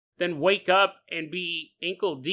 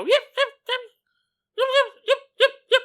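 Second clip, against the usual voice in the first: much higher, yappy little dog yips; Chihuahua yips; 5 dB louder, faster than usual. Shown as a demonstration. Imitating how Chihuahuas bark, high yapping. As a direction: yap like a tiny Chihuahua. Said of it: "Chihuahuas go".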